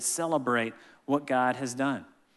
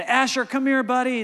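calling out; 10 semitones higher, 5 dB louder than usual. Real, not acted. Calling son across the pool.

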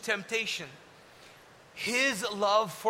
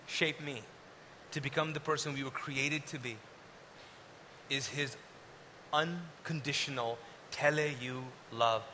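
soft preaching; 8 semitones lower, 8 dB quieter than usual. Acted. Preacher drops to soft intimate close.

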